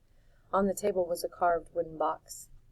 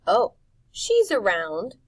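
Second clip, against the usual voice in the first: mischievous; 3 semitones higher, 8 dB louder, slower than usual. A narrator's reading. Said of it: "Rictus said mischievously".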